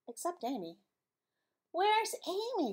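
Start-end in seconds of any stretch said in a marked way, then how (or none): 1.7-2.7 higher, slower puzzled father; father asking. Acted. Father at breakfast asking where Amy is, higher and slower. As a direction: ask it like a puzzled dad. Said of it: "said the father".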